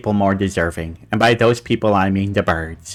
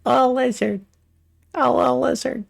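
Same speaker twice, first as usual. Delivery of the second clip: put-on baby-talk coo; 13 semitones higher than usual. Cooing over lizard drawing.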